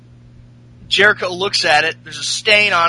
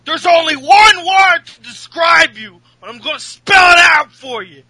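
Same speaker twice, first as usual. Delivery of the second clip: higher and louder, spelling it out slowly; mock insult shout; 10 semitones higher, 8 dB louder, slower than usual. Host imitating Cass insulting Enzo, slow spelled shout. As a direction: build to a screamed final word. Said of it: "Cass says".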